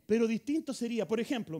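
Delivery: ordinary talk, nothing marked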